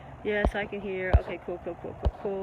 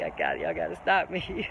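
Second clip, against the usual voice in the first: ordinary delivery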